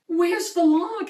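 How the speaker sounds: a high, alarmed cry of "Where's the log?"; panicked little robot calling out; anxious cry. A narrator's reading. Robot finds the empty bed, crying out in alarm. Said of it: "he cried".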